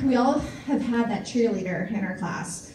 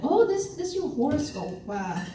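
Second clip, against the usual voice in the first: fake Chinese horoscope reading; 3 semitones higher than usual. Faking a horoscope for a cute boy, hammed-up slow translation. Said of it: "I would, I would fake it".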